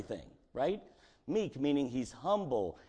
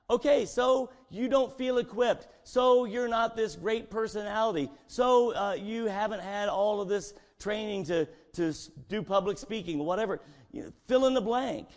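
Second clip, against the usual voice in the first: higher, louder preaching; 7 semitones higher, 4 dB louder than usual. Acted. Preacher to congregation, raised and fervent. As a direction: drive it home to the pews.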